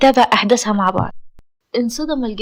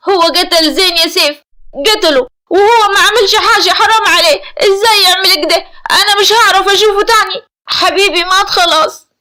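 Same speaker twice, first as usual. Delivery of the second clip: high, loud acted hysterical grief; tearful breakdown; 6 semitones higher, 6 dB louder than usual. A narrator's reading. Afnan telling Seif her beloved was killed, hysterically tearful. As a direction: wail the words as if falling apart. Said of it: "أفنان بانهيار وبكاء".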